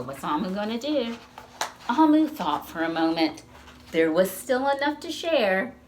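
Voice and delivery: ordinary delivery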